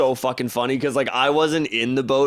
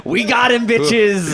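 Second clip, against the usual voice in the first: loud, raised-pitch victory cry; triumphant shout; 6 semitones higher, 6 dB louder than usual. Pretend. Podcaster celebrating with friends, triumphant shout. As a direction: crow it out loudly to the whole room.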